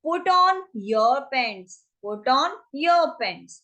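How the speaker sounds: drawn-out teaching delivery; slow demo phrase; slow model phrase. Shown as a demonstration. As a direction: stretch it so learners can follow. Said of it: "क्या कहेंगे हम".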